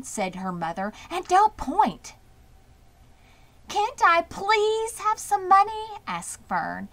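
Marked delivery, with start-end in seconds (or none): 1.1-2.2 higher, slow, louder scolding; mother correcting daughter; firm rebuke. A narrator's reading. 3.7-5.9 put-on high, slow childish begging; little girl begging mother for coins; pleading girl. A narrator's reading. Fern pleading.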